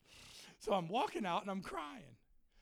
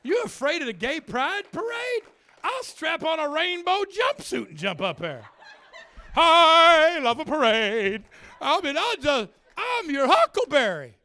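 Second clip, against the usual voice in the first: high, shouted enthusiasm; exuberant boast; 9 semitones higher, 17 dB louder than usual. Acted. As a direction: holler it with swelling glee. Boasts he will join the parade.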